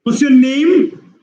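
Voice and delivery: "name" lifted high and stretched; slow, high-pitched question climbing on "name"; rising tone. Shown as a demonstration. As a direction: push "name" up high and hold it. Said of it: "give it in the rising tone".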